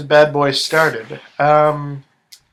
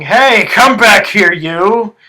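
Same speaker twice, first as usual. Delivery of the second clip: loud, raised-pitch holler; screaming minotaur; 4 semitones higher, 6 dB louder, slower than usual. Acted. Minotaur shouting after a fleeing cave bear, screaming. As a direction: yell after someone running off, stretched out. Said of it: "the minotaur was screaming".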